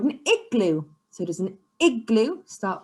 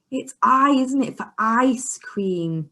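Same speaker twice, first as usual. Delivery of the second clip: normal talking voice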